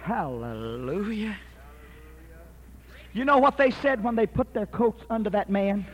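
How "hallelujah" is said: ordinary talk, nothing marked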